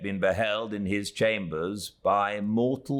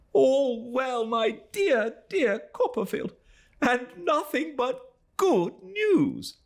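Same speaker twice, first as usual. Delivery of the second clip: much higher, slow gushing joy; cried with pleasure; slower than usual. Acted. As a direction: Traddles overflowing with delight. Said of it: "we cried with pleasure".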